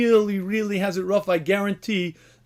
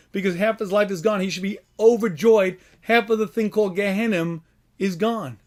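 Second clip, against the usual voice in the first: ordinary talk, nothing marked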